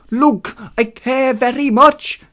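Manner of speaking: high, loud, very slow delivery; slow, hammed-up character voice; Hermes speaking slowly. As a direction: draw out each word emphatically. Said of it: "Hermes said slowly".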